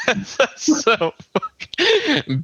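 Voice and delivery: laughing. Real, not acted. Laughing, recalling punchline.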